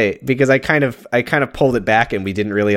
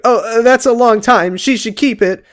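high-pitched imitation of a reluctant girlfriend; slow, raised voice, backing away from a gift; put-on polite refusal; 10 semitones higher, 3 dB louder, slower than usual. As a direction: act out a squeamish decline, pitched way up. Acting out Madison declining the necklace.

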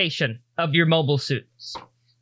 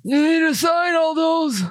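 put-on high, loud old man bark; 12 semitones higher, 3 dB louder than usual. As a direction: order it like a cranky old clerk. GM voices old clerk.

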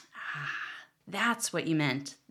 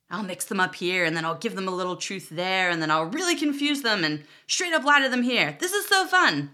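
wild-eyed lunatic; 2 semitones higher, 5 dB louder than usual. Pretend. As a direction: plot mischief, building to giddy glee. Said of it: "It makes God into a wild-eyed lunatic".

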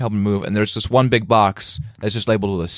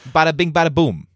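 punchy, pitched-up Brooklyn demo; Brooklyn accent; 6 semitones higher than usual. Shown as a demonstration. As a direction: show how Brooklyn really sounds. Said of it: "in a Brooklyn accent".

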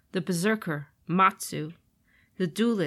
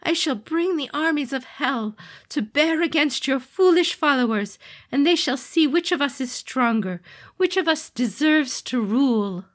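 loud, high threatening demon voice; menacing demon lord; 8 semitones higher, 6 dB louder than usual. Acted. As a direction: declare war with cruel pride. Demon lord declares war.

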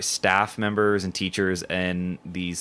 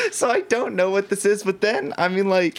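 laughing; 12 semitones higher than usual. Real, not acted. Laughing at soap dispenser story.

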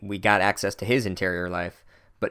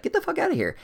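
mock shrill teacher; faster than usual. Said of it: "she's like".

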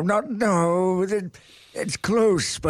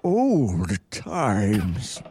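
put-on drawn-out, dreamy old man voice; slow wistful old man; 4 semitones lower, much slower than usual. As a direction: savour each word like a memory. Old man intoning phrase slowly.

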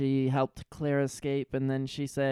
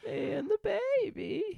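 mock dazed Claire; 4 dB quieter than usual.

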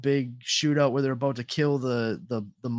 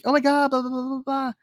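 high, gushing mock teenage-girl voice; pitched-up mimicry of a schoolgirl; imitated high-school girl; 9 semitones higher than usual. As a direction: imitate an excited girl chattering to her friends. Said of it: "And she was like".

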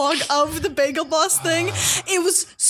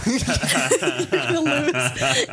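laughing; 8 semitones lower, much slower than usual. Real, not acted. Laughing while repeating the warning.

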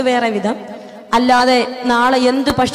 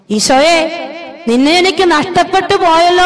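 lamenting cry; 6 semitones higher than usual. Acted. Voicing a sinner's lament to Jesus.

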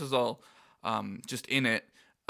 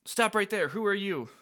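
Rapunzel voice; 7 semitones higher than usual. Retold or imitated. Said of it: "Rapunzel says".

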